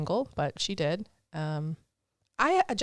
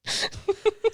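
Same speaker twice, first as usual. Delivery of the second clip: high burst of laughter; 4 dB louder than usual. Real, not acted. Laughing at own story.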